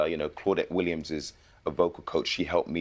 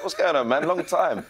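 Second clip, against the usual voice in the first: put-on bright, raised hail to an old friend; mock-surprised hello to an old friend; excited greeting; 11 semitones higher, 5 dB louder than usual. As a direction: call out high and loud like spotting a mate. Retold greeting to Idris in lift.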